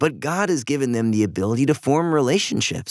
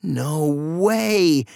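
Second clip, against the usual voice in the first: put-on raised, astonished child's exclamation; amazed exclamation; 4 semitones higher than usual. A narrator's reading. Eva hearing the last three fish are here, amazed exclamation. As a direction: exclaim it in delighted disbelief. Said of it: "Eva exclaimed".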